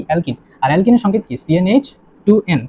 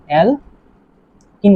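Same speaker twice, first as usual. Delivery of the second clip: Alkyne slowly, a pause after the first syllable; drawn-out dictation; much slower than usual. Shown as a demonstration. Teacher introducing a term to students, alkyne slowly syllable by syllable. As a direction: break alkyne into two separated syllables.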